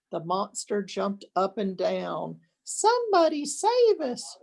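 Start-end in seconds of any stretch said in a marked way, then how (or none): 2.6-4.4 shriek. A narrator's reading. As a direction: scream for help. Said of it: "shrieked the dogs".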